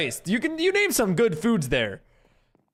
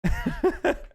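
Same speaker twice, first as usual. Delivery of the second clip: high airy giggle; 9 semitones higher than usual. Real, not acted. Laughs after the mock talking-to.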